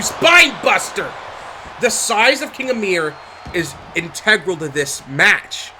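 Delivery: ordinary talk, nothing marked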